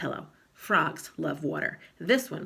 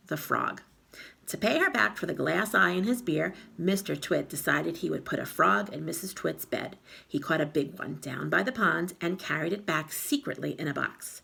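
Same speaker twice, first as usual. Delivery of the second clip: usual speaking voice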